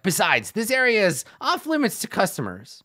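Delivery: a goblin shooing customers away; goblin guard. Retold or imitated. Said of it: "this one goblin is like".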